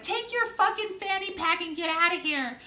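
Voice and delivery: hammed-up furious wife; angry wife. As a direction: boss him out the door. Wife ordering man out.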